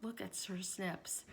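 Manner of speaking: ordinary delivery, nothing marked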